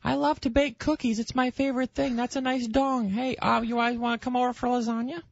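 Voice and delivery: high, sweet church-lady voice; mock prim housewife. Mocking the wives at a sex toy party in a prim housewife voice. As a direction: chirp it like a prim neighbourhood lady. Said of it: "It's not like they sit around going".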